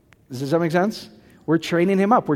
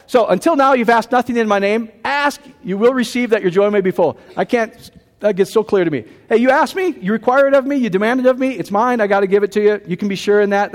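raised, ringing preacher's voice, pressing each line home; fervent preaching; 4 semitones higher than usual. Real, not acted. Preacher urging his congregation to claim God's promise, fervent. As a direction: preach it to them like a promise that can't be broken.

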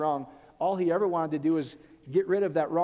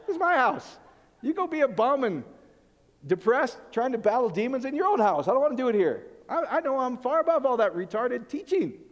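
indignant scolding; 6 semitones higher, 4 dB louder than usual. Retold or imitated. Scolding demon-hunting visitor.

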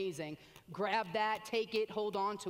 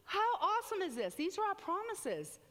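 much higher, marvelling; awed excitement; 9 semitones higher than usual. Acted. Preacher to the congregation, high and amazed. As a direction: lift it up in wonder.

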